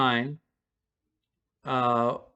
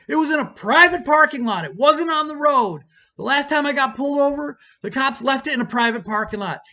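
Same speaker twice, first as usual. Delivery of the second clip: loud, high-pitched indignant outburst; re-enacted outraged tirade; pissed-off rant; 10 semitones higher, 6 dB louder than usual. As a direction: lay into someone, voice pushed way up. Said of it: "but I was pissed".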